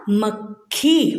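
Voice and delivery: मक्खी with a half क and a full ख, slow; slow correct pronunciation. Shown as a demonstration. Teacher models the right spelling sound, slow. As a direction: lean on the doubled sound in मक्खी, slowly. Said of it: "इसलिए क आधा होता है और ख पूरा".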